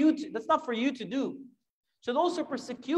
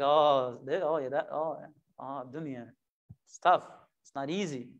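lower, softer, sighing mock excuse; weary resignation; 8 semitones lower, 4 dB quieter than usual. Preacher imitating grown-ups excusing their failures, weary low sigh. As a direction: mumble it like a tired excuse.

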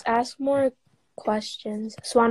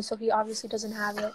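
ordinary talk

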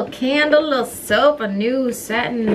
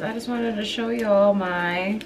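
usual speaking voice

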